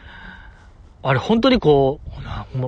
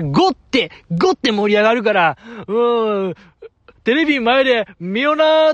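much higher, excited mock Kansai dialect; fake Kansai excitement; 6 semitones higher than usual. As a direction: hype it up in over-the-top fake Kansai. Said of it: "関西人も使わへん関西弁が出るんで". (関西人も使わない大げさなエセ関西弁で興奮気味に)

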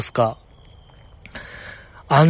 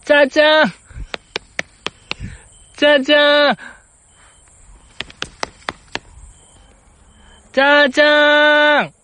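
high, held sing-out call; 11 semitones higher than usual. Real, not acted. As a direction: call high and sustain the last syllable longer each time. Repeated call, getting no response. (高く伸ばして呼びかける)

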